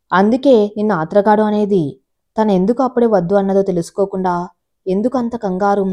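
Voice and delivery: ordinary delivery, nothing marked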